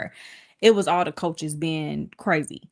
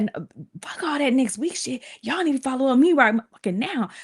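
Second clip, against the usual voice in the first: imitating mom cussing; 7 semitones higher than usual.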